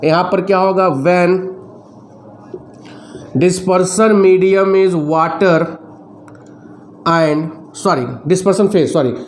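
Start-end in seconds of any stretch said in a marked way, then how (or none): 1.0-9.1 each word spaced out for students to copy; slow dictation. Shown as a demonstration. Teacher dictating notes to students, slow and spaced.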